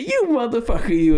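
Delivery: high, loud mock fury; mock outrage. Finding the hidden truck, yelling at the prankster friend in mock anger. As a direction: blow up at him, half laughing.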